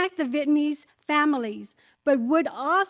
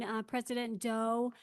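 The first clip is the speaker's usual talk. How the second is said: ordinary talk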